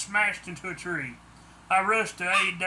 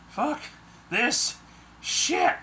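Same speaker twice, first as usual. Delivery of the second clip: frustrated curse; 6 semitones higher, much slower than usual. A narrator's reading. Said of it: "I said, fumbling for my keys".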